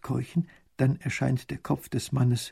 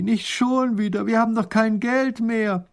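groaning; 9 semitones higher, 3 dB louder than usual. Acted. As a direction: complain in despair. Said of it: "stöhnt Raffigny".

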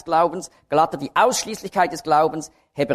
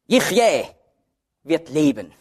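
Yechyeh set apart by pauses; stress on Yechyeh. Shown as a demonstration. Preacher marking the accent in Hebrews, stress on Yechyeh. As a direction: punch Yechyeh, then drop for leben. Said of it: "jedes Mal wird der Akzent auf ein anderes Wort gelegt".

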